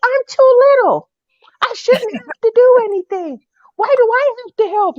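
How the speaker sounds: put-on high, loud childish protest; whine-yell that it's not fair; yelling back. Little boy yelling back at the nanny, loud protest. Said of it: "he starts yelling back at me".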